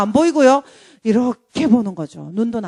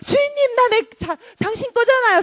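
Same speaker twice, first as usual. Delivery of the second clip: high-pitched, protesting cry; mock screaming plea; 10 semitones higher than usual. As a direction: cry out possessively, hammed-up and high. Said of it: "소리를 질러요". (높은 소리로 매달리듯 외치는 연기)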